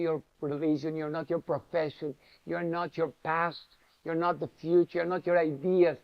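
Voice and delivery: ordinary talk, nothing marked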